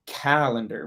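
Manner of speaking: "calendar" stressed normally on CAL; CAL-en-dar, front-stressed; stress on first syllable. Shown as a demonstration. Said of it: "we emphasize a certain part of them".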